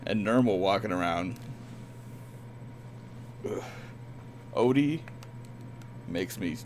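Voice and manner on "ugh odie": ordinary delivery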